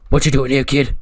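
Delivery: loud, confrontational tough-guy voice; Karrion Kross. Acted. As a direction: challenge the kid loudly. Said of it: "Karrion Kross kinda goes".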